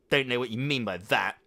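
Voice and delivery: slurring the words; slurred speech. Acted. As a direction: slur it drunkenly. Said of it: "slurred Imbecilus".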